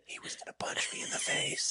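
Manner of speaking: high, whispered joking excuse; mock whisper. Co-host joining in the whispered excuse to the officer. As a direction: whisper it like a scared kid.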